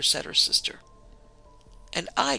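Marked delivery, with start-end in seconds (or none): 1.9-2.4 short cry, pitched higher; husband's cry. A narrator's reading. Husband backs up his sister-in-law, crying out higher. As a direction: chime in on a raised pitch. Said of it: "cried the husband".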